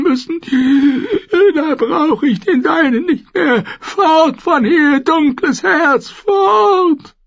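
put-on high, slow, sobbing old woman; crying crone shouting her away. A narrator's reading. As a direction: weep and drive her away. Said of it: "Sie begann zu schluchzen".

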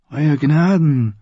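put-on high, slow old man; courteous old maester. Old maester addresses the king, slow and courteous.

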